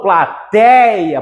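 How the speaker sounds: PLATEIA drawn out on an open ÉI; slow "pla-TÉI-a" with a wide open e; open diphthong, stressed. Shown as a demonstration. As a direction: lean long on the open TEI of PLATEIA. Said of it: "por isso que o ditongo é aberto".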